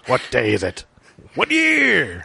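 gravelly action-hero soldier voice; Kyle Reese voice. Shown as a demonstration. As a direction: rasp it out like a hunted soldier. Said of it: "that'll help me do my Kyle Reese".

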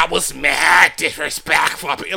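slow, stretched fake northern accent; hammed-up up-north accent; mock up-north accent. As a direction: chop and drag it in an exaggerated up-north accent. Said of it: "when y'all say that".

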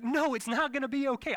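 loud, high-pitched frantic protest; panicked retort. Retold or imitated. Anxious husband contradicting his calm wife, frantic protest. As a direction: throw the reassurance back, high and loud.

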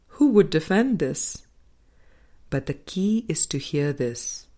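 ordinary talk, nothing marked

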